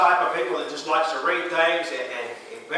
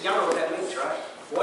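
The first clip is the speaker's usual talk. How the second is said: ordinary delivery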